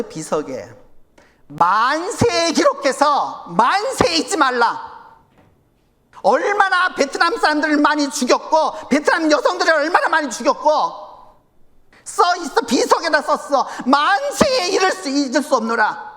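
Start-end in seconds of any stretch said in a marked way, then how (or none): 1.5-4.9 slow, weighty proclamation; solemn inscription reading. Acted. 13.9-16.1 archaic proclamation, raised pitch; solemn inscription reading. Acted.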